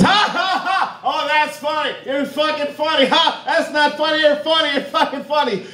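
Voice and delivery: very high, squealing mock heckle; Kyle Farrell heckling. Comedian imitates Kyle heckling a rookie open micer, mocking squeal. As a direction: imitate an obnoxious heckler far up in pitch. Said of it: "He heckles the rookie open micer".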